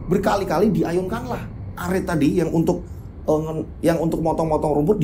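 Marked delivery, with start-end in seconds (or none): none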